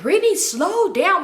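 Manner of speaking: high-pitched, drawn-out warning; alarmed plea. Retold or imitated. Passenger tells the speeding friend to slow down, mock alarm. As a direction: act out pleading with a reckless driver.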